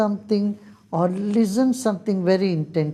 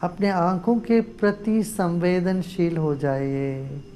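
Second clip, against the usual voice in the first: guided meditation; faster than usual. Real, not acted. Teacher guides group to close eyes and breathe, guided meditation. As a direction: lead them gently into stillness, drawing out phrase endings.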